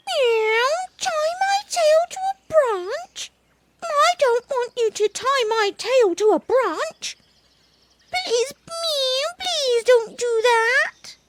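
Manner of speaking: squeaky pleading kitten, high pitched. Acted. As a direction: beg like a little kitten.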